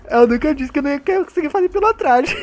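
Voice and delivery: high laughing gloat; amused laughter. Real, not acted. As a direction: laugh while telling it. Laughs after opponent falls. (rindo da desgraça alheia)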